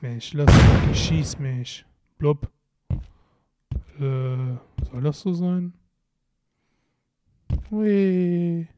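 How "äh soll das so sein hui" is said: ordinary delivery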